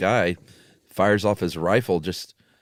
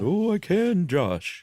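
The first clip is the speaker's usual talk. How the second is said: much higher, jolly Santa Claus voice; Ambrose Bierce's ghost; 10 semitones higher, much slower than usual. Acted. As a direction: answer as the dead author, like a cheery Santa. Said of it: "You sound like Santa Claus".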